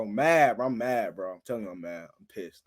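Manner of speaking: low, very soft muttering; subdued retold anger. Man recalling crying in his room, quietly fed up. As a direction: let the voice sink away while insisting.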